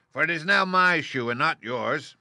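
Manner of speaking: loud, high, gloating witch voice; taunting witch; wicked witch. Acted. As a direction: lord it over the girl. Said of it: "retorted the witch".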